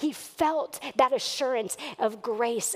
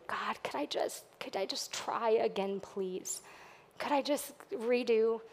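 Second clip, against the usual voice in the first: soft, hushed plea to God; a quiet, begging prayer for another chance; hushed pleading prayer; 6 dB quieter than usual. Retold or imitated. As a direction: pray it softly, almost begging.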